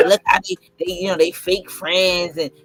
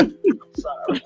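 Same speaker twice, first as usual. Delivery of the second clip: laughing apology; 9 semitones higher, slower than usual. Real, not acted.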